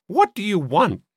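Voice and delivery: high, cracked voice. A narrator's reading. Sister answering from the dim hallway, high and cracked. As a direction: call back in a high cracked voice. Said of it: "A high, cracked voice".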